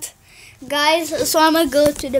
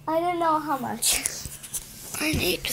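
whisper; 13 dB quieter than usual. Real, not acted. Whispered aside while gaming.

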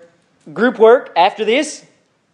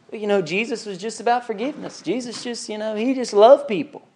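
much softer, wispy mock voice; mild-mannered objector; 2 semitones higher, 6 dB quieter than usual. People excusing Jesus as only loving, soft and mild. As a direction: imitate a meek, hedging objection. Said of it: "people who say".

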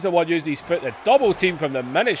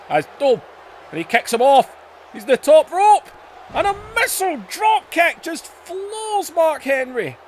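rising, louder, then yelling high; excited commentator shout; 12 semitones higher, 3 dB louder than usual. Real, not acted. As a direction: call the big move at full voice. Play-by-play, a high-flying move.

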